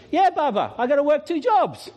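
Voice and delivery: high, animated character voice; imitated Malawian guard. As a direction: answer as the guard, pitched well up. Voicing the guard's reply.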